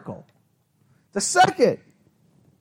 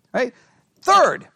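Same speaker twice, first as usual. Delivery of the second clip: usual speaking voice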